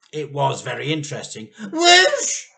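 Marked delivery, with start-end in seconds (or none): 1.7-2.5 very high, loud shrieking whoosh; chicken shriek. A narrator's reading. The chicken shooting up into the air, shrieking. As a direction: shriek like a startled bird. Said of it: "shrieked the chicken".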